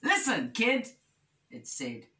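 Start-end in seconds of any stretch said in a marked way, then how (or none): 0.0-1.0 higher, lightly voiced telling-off; mildly stern storybook fish; scolding wishfish. A narrator's reading. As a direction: pull the kid up short. Wishfish scolds Little Bear.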